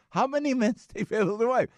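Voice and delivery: high mock Pakistani accent. Driver pressing his question, in a mock Pakistani accent.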